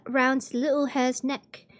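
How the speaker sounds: usual speaking voice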